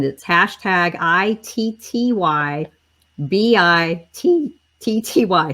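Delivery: "I T T Y B I T T Y" spelled slowly, each letter separate and clear. Shown as a demonstration. Host spelling the hashtag for viewers, slow and letter by letter. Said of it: "And I'm gonna say it again".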